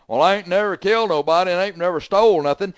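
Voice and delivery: a hint of a smug braggart; mock boast. Preacher mocking a self-righteous listener, a mild mock boast. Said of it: "You always puff your chest out".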